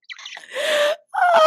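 real helpless shriek of laughter. Real, not acted. Cracking up at the story.

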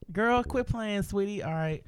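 bubbly high voice, caught at work; sassy girl voice. Retold or imitated. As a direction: playfully scold a friend in a bubbly high voice. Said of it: "I didn't mean to talk in that voice".

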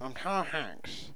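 slow, half-hearted Hanks line; Tom Hanks attempt. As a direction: give up mid-impression. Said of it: "I can't. I don't think I can do it".

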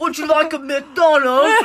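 high, loud, slow British parody; mock British accent. Girl teasing Europeans for laughs, mock British accent. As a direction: put on a cartoonish British accent.

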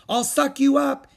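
very high, loud playful threat; shouted. A narrator's reading. Howie with the vacuum, shouting at the kids. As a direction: roar it like a monster game. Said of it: "he shouted".

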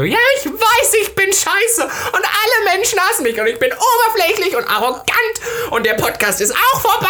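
high-pitched, hammed-up crying rant; wailing. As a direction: re-enact a tearful meltdown, comically overblown. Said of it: "angefangen zu heulen". (hohes, übertrieben nachgespieltes Heulen)

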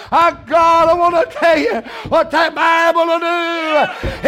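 high, loud, drawn-out preacher's shout; full-force preaching. Real, not acted. Preacher urging the church to keep coming, preaching at full force. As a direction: preach it hard, pushing every phrase up high.

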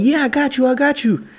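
high, breezy reassurance; playfully posing as the photo expert; mock confident. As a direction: promise it all easily, hamming it up. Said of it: "I could easily be like".

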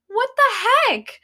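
baffled, stretch it out in disbelief. Real, not acted. Reacting to absurd fan ship.

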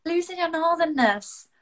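teasing family. Retold or imitated. Family laughing at her lost northernness, teasing. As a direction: imitate relatives poking fun. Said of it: "they're like".

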